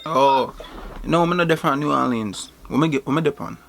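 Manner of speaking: relaxed Jamaican patois; Jamaican accent. Pretend. Denying he's from New Orleans, in a Jamaican accent. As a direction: sell the Jamaican act. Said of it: "You have a nice accent".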